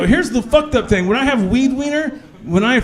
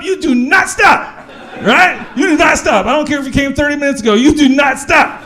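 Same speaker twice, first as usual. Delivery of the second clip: emphatic shout; 6 semitones higher than usual. Real, not acted. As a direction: pound it home. Comic insistence to audience.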